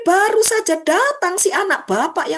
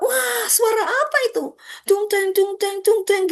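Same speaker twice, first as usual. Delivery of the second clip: high, puzzled voice of the elder son hearing music; curious son straining to hear the music; 7 semitones higher than usual. Acted. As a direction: raise the pitch, wonder aloud at the noise. Elder son hears party music.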